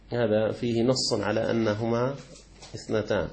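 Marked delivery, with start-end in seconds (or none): none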